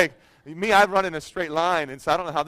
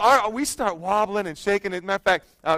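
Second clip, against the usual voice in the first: ordinary delivery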